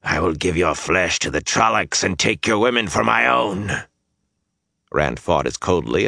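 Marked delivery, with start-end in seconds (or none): 0.0-3.8 menacing creature rasp; rasping Myrddraal. Acted. Myrddraal threatens Rand's flesh and women, rasping. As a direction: rasp the threat like crumbling dead leaves. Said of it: "rasped at him in a voice like the crumbling of dead leaves".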